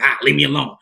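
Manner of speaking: loud, irritated mock outburst; imitated brush-off. Homeless man rebuffing a shelter worker, loud imitation. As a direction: imitate a man pushing you away. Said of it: "That's how he said".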